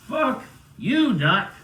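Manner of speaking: a high, loud mock-defiant jab; defiant retort. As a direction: toss it off as a cocky joke. Refusing the doctor's drug.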